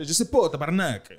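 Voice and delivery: hammed-up Québécois, "pas" rounded to "po"; Quebec French. Joking about how locals would say it, Quebec French. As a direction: play a swearing Quebecer, broad local accent. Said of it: "Ils vont dire".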